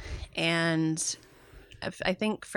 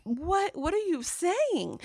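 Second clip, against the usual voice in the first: disbelieving shock; 9 semitones higher, slower than usual. Retold or imitated. Retelling shock at the doctor.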